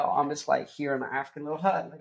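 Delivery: ordinary talk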